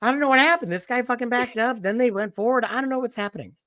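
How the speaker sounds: put-on high, baffled old man voice; confused old man. Old man explaining the chase to his friend, lightly high and baffled. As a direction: act out a bewildered old bystander. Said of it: "he's like".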